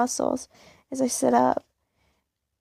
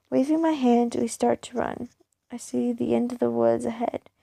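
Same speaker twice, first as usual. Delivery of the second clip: ordinary delivery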